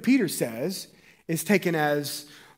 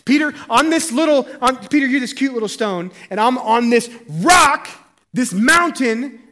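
proud Jesus proclaiming; 8 semitones higher, 9 dB louder than usual. Acted. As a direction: make the mountain sound huge and glorious. Jesus proudly naming the mountain.

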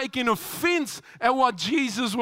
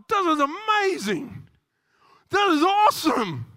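higher, eager and excited; gushing wonder; 6 semitones higher, 3 dB louder than usual. Retold or imitated. New churchgoer amazed at church, higher and excited. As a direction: play a newcomer dazzled by church.

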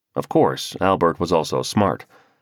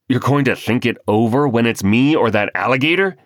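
raised, louder disbelieving question; he can't believe what he just heard; incredulous; 5 semitones higher, 4 dB louder than usual. A narrator's reading. Husband to wife, incredulous at her answer. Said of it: "Homer was incredulous".

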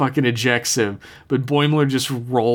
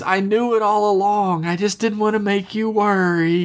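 high, sustained sweet mock voice; hammed-up tender creature voice; talking dog; 9 semitones higher than usual. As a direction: pitch it up as a sentimental cartoon dog. Dog's sentimental reply to Tendi.

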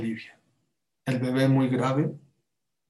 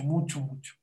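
plain reading voice

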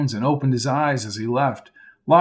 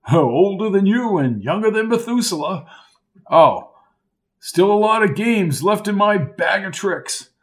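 lightly voiced old Lark, high and jolly; a hint of a jovial old-timer calling him old boy; hearty old college man; 6 semitones higher than usual. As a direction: play the chummy old coach, a touch higher. Lark's character voice.

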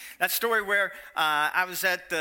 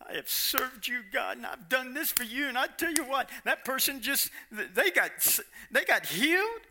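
higher, petulant mock grumble to God; whiny complaint; 6 semitones higher than usual. A resentful believer complaining to God, whiny. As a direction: whine like the jealous older son.

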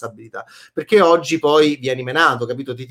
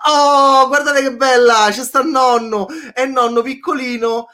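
excited; 7 semitones higher, 4 dB louder than usual. Real, not acted. Delighted at new emoticon.

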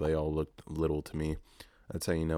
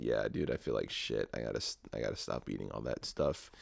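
low, tired self-quote; 7 semitones lower, 6 dB quieter than usual. Retold or imitated. Quoting himself feeling sick.

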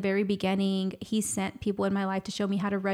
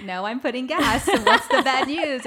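raised, louder retold comeback; acted-out impatience, pitched up and loud; impatient retort; 5 semitones higher, 9 dB louder than usual. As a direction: brush off the question and demand the news. Retold phone call, impatient reply.

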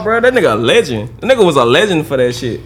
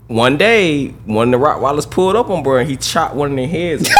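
normal talking voice

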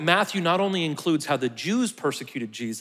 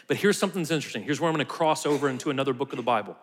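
ordinary talk

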